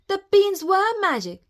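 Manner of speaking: put-on high, loud boyish discovery; excited cry. A narrator's reading. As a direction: cry out in delighted wonder. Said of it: "Jack cried".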